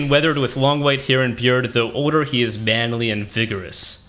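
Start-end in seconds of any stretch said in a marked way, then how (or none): 1.7-4.1 dramatic read. Acted. Reading a sultry book description.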